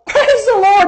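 high, elated retold exclamation; jubilant praise. Youth leader answering the healed girl, jubilant. Retelling his joyful response.

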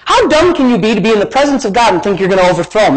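ordinary talk

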